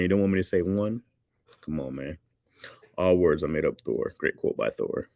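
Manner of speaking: ordinary talk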